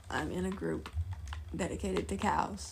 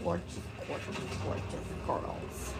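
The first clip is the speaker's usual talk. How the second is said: whispered gorgeous; 8 semitones lower, 7 dB quieter than usual. Acted. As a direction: whisper it like a secret. Echoing friend in whisper.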